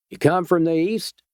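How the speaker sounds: a suspicious Georgia local sizing up a stranger; Georgia twang. A narrator's reading. A Georgia vigilante questions the stranger in a Georgia twang. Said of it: "in a Georgia twang".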